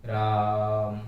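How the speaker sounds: dramatic stage call of राम; stage pluta Raam. Shown as a demonstration. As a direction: project राम on stage with a long held आ. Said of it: "किसी को बोलना है कि".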